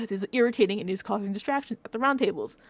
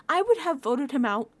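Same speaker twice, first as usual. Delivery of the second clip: normal talking voice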